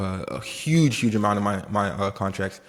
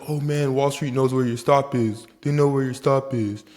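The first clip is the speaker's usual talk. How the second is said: higher-pitched mock grumble; mock complaining traders; 4 semitones higher than usual. Trader imitating people who blame Wall Street, mock complaint. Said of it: "people always say".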